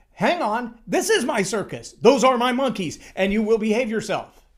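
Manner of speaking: mock stern take-charge voice; firm leader stepping in. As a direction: lay down the law like a dad.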